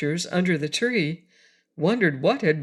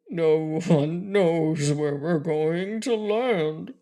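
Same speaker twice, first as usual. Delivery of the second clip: slow, on the verge of crying; the Lion, slow and close to crying; near tears; slower than usual. A narrator's reading. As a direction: hold back tears, slowly. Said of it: "in a voice that sounded as if he were going to cry".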